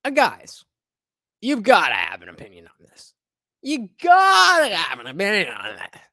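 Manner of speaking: hyped host. Acted. Host hyping panel time.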